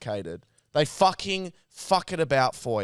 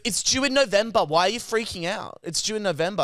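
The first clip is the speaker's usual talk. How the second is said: mock him losing patience; hammed-up irritated phone guy; frustrated operator; 5 semitones higher than usual. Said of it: "He got so frustrated so quickly".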